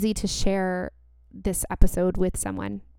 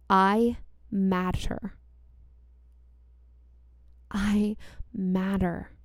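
slow affirmations; slower than usual. Shown as a demonstration. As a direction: speak each line slowly and gently. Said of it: "So repeat after me".

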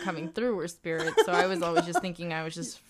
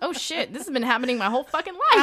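retold shocked realization; 5 semitones higher, 8 dB louder than usual. Retelling the realization.

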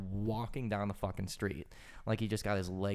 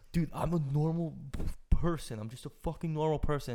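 lightly mimicked, higher and louder plea; protesting driver; 5 semitones higher, 5 dB louder than usual. Retelling the driver confronting them, raised mock protest. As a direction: plead innocence as an imitated older guy.

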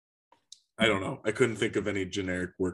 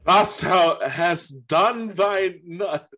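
slow, loud, high theatrical decree; Shakespearean proclamation; 7 semitones higher, 7 dB louder, much slower than usual. Acted. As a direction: declaim it like an old stage actor.

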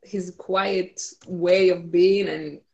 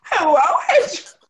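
loud, laughing, higher greeting; laughing shout; 10 semitones higher, 7 dB louder than usual. Retold or imitated. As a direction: belt it out mid-laugh. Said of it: "echoing the quote, laughing".

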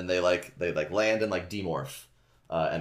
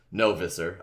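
ordinary talk

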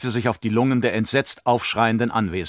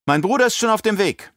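put-on cheeky girl; 10 semitones higher than usual. Alia taunts the emperor.

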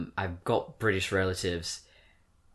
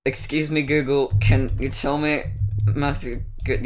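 louder, spelled-out request to a device; clear voice command; 4 semitones higher, 6 dB louder than usual. Real, not acted. Asking Google on the phone, loud and deliberate. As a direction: speak up so the phone hears.

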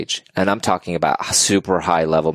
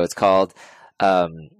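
ordinary talk, nothing marked

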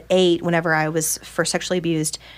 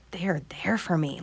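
softer, breathless inner thought; awed whisper; 7 dB quieter than usual. Retold or imitated. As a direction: say it to yourself in wonder. Inner realization, parents support.

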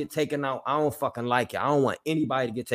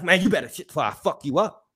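higher-pitched mock guard threat; threatening guard; 5 semitones higher than usual. Guard threatening a prisoner who speaks up, imitated threat. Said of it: "this how you get talked to".

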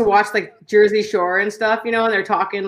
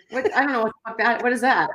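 ordinary talk, nothing marked

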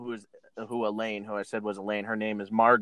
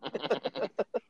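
high laughter; 8 semitones higher, 4 dB quieter than usual. Real, not acted. Laughs at ladder gag.

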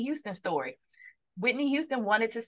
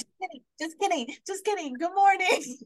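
sing-song take-back; 9 semitones higher than usual. Retold or imitated. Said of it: "But then I was like".

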